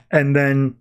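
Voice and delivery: ordinary delivery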